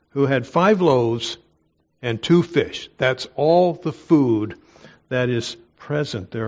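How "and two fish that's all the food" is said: ordinary delivery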